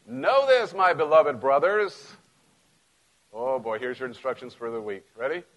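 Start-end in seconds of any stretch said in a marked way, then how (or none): 0.1-2.1 ringing preacher read, high then slowing; loud pulpit scripture reading. Acted. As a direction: proclaim the verse to the congregation. Reads scripture aloud.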